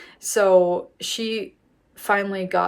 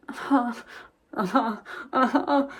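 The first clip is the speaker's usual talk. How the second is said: distracted uh-huh; 4 semitones higher than usual. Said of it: "not really listening at all".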